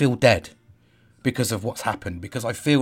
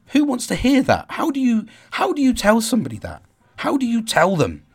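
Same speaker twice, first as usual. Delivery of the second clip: anguished; 10 semitones higher than usual. Real, not acted. Anguished outburst about his daughter.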